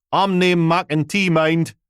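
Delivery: put-on old Aberdonian man, high and loud; thick Aberdeen brogue, calling out from the door; broad Aberdonian accent. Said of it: "Aberdonian accent you could plough fields with".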